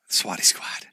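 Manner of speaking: breathy whisper of the slogan; Swatty Squad breathed out, no voice. Acted. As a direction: whisper it to yourself like a secret. Whispered to himself.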